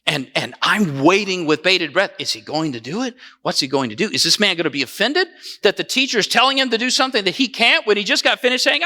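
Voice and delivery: ordinary talk, nothing marked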